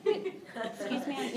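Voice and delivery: soft, slow timid excuse me. Shown as a demonstration. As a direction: barely dare to speak up. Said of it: "I wouldn't even say that loudly".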